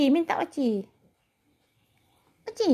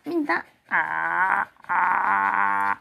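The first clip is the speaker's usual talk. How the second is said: ordinary talk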